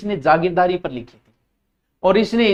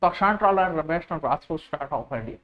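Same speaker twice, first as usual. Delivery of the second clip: slow soft repeat; 4 semitones lower, 7 dB quieter, much slower than usual. Shown as a demonstration. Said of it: "ऐसे English बोलने में स्वाद आता है".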